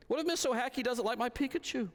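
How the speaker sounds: put-on a child confessing fear at bedtime; little daughter. Daughter voiced in bedtime story.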